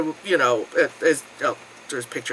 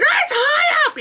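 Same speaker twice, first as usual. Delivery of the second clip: mock shout; slower than usual. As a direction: yell it out breathless and proud. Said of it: "being like".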